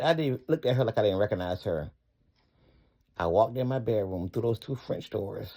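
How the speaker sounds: usual speaking voice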